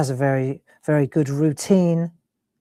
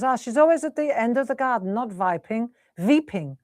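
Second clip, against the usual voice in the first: higher, imitated German mum correcting; mum's German accent, W turned to V; 6 semitones higher than usual. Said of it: "and she's German, isn't she".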